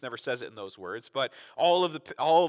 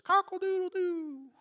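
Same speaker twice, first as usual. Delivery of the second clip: high, slow mock rooster crow; cock-a-doodle-doo rooster imitation. Rooster crow Peter heard.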